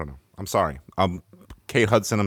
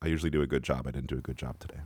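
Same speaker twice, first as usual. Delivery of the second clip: softer, low, deflated apology; dejected; 6 semitones lower, 9 dB quieter than usual. Real, not acted. Host apologises to cohosts for his mistake, dejected. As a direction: sound genuinely down on yourself. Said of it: "I'm just having a hard time".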